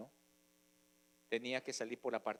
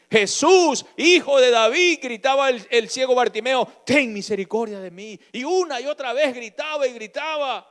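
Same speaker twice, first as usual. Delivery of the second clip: loud, high, impassioned preaching; preaching at full force; 7 semitones higher, 17 dB louder than usual. Acted. Preacher urging the congregation to beg God for mercy, shouting. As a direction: cry it out to the congregation like a revival preacher.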